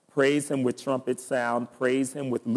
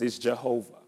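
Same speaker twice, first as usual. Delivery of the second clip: ordinary talk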